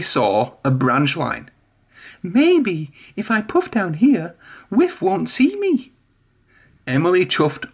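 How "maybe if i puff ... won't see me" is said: high, slow and sly; Emily's voice. A narrator's reading. Emily schemes to hide from Whiff, high and slow.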